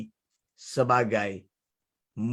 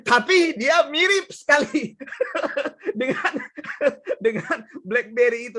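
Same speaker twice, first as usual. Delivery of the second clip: laughing; 9 semitones higher than usual. Real, not acted. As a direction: crack up mid-sentence over the joke. (tertawa geli sampai kalimat terputus)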